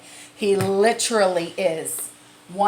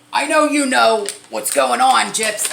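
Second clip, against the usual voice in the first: imitating her dad, higher and louder; dad voice, emphatic; 5 semitones higher, 7 dB louder than usual. Retelling her dad on the phone, higher and louder. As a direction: lean in like a worried father insisting.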